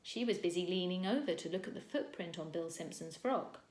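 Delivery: plain reading voice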